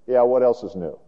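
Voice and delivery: dropped-pitch, unimpressed dismissal; acted weary scepticism. Disciples hearing the news all day, sceptical and unimpressed. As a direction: brush the news off, low-key and doubting.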